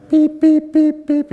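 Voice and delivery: high loud imitated microwave beeping; microwave beeps. Preacher mimics his mom's microwave in a loud, high beep.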